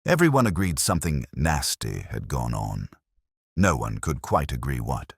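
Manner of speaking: usual speaking voice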